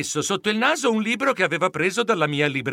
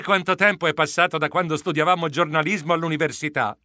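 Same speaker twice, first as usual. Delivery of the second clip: plain reading voice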